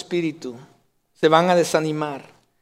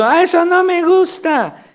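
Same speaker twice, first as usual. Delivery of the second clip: much higher, slow fussy whine; spoiled child; 12 semitones higher, 5 dB louder than usual. Retold or imitated. Little boy at the table refusing his food, high slow whine. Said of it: "el niño dice".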